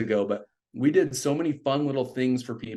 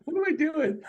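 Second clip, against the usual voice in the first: high, panicked question to himself; nervous mid-break-in, half-joking; mock panic; 14 semitones higher than usual. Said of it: "I'm freaking out".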